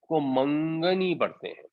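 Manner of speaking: ordinary talk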